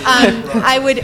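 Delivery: real laugh spilling into the answer. Real, not acted. Presenter laughing at the rocky road joke. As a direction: laugh it off, then start the answer.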